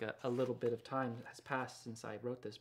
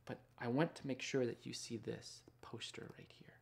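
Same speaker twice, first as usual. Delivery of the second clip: soft whispered aside; 10 dB quieter than usual. Real, not acted. Author whispering to children during the reading, hushed whisper. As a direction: whisper it so you don't break the quiet. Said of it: "I'm supposed to be quiet at this part".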